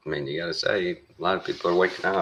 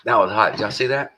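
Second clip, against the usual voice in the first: startled by the heat; 8 semitones higher, 6 dB louder than usual. Real, not acted. Burnt by hot food.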